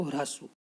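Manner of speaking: ह्रस्व said softly and clearly; ह्रस्व spoken as a soft, careful model word; pronunciation demo. Shown as a demonstration. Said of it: "ह्रस्व बोलते हैं इसको".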